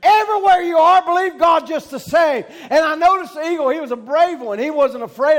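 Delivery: high, emphatic pulpit shout; full-force preaching. Real, not acted. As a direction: proclaim it forcefully to the congregation.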